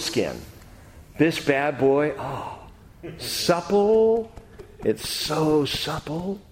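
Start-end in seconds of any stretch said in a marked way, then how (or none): 2.2-6.4 savoring delight. Real, not acted. Savoring the leather Bible cover.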